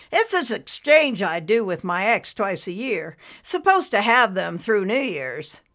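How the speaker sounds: a stranded dad venting to strangers; lightly voiced worn-out father; tired waiting father. Man explains his custody exchange.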